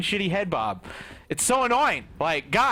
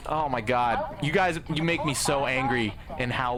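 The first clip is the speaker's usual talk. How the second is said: normal talking voice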